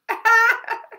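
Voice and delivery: high burst of laughter; real laugh. Real, not acted. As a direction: laugh out loud, genuinely amused. Teacher laughs at picture.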